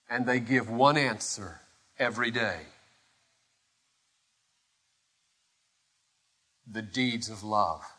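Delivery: low, soft, weighty pulpit voice; hushed solemn preaching. Acted. Preacher giving the one answer, hushed and solemn. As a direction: drop right down and let it sink in.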